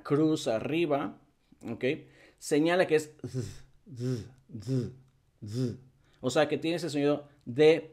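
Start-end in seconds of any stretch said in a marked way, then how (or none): 4.0-5.8 "z" as a short buzzing voiced th, tongue lightly bitten. Shown as a demonstration. Teacher shows students the buzzing sound, voiced th. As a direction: say "z" like a little vibration on the tongue. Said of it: "darle el mordisquito".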